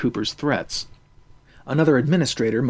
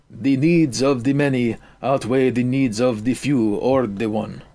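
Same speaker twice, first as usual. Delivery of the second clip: usual speaking voice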